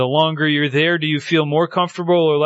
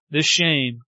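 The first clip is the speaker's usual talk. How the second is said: ordinary talk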